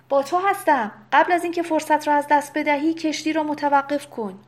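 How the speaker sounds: high, loud, rushed command; گودلفین shouting. A narrator's reading. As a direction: shout an urgent order across the water. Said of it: "گودلفین فریاد زد". (فریاد بلند، تند و آمرانه)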